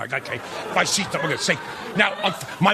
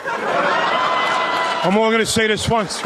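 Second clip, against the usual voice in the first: tough guy voice; much slower than usual. Retold or imitated. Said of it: "he said".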